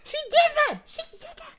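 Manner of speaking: high-pitched shriek of delight with laughter; ecstatic screaming. Retold or imitated. Fan at three in the morning, shrieking at the twist in high-pitched delight. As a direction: squeal with shock and joy at the reveal. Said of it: "and I just go".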